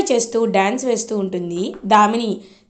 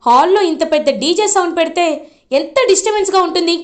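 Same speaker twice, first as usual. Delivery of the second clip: annoyed scolding; 6 semitones higher than usual. Retold or imitated. Darshan scolds loud party.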